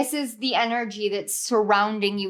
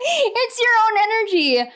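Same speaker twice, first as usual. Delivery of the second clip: laughing; 10 semitones higher, 4 dB louder than usual. Real, not acted. Laughing at repeated card.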